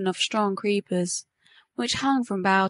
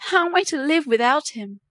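higher-pitched, restrained despair; distressed cry; 5 semitones higher than usual. A narrator's reading. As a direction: plead with a touch of anguish. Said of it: "she cried".

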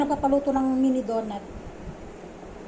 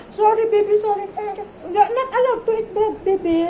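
put-on high, sing-song apology to a baby; cooing baby talk; 7 semitones higher, 3 dB louder than usual. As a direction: coo the sorry like to a toddler, half laughing. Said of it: "sabi niya".

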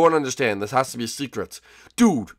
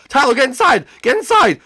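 urgent call; 8 semitones higher, 5 dB louder than usual. Acted. Urgent calling to brother.

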